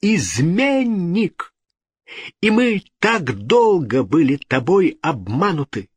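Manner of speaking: high-pitched, indignant tirade; outraged cry. A narrator's reading. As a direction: denounce the traitor with imperious outrage. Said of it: "вскричала Екатерина".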